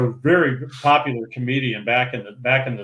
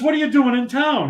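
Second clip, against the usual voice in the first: high voiced Brenner; 11 semitones higher than usual.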